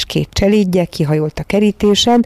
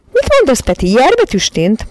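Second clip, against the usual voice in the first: high, louder scolding; scolding lady; 6 semitones higher, 4 dB louder than usual. A narrator's reading. Miss Lark scolding Peti, high and loud. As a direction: tell off a naughty boy.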